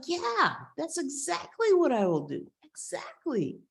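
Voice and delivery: put-on high, elated boy; acted joyful agreement; overwhelmed with joy. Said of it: "overwhelmed with joy on this idea".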